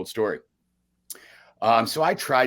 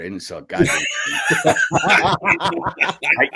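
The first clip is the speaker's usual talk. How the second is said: bursting out laughing, much higher and louder; laughing shout; 11 semitones higher, 6 dB louder, much slower than usual. Real, not acted. Laughing to the hosts about his failed sales job. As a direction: crack up while admitting it.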